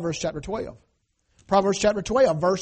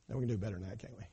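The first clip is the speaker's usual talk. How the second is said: ordinary delivery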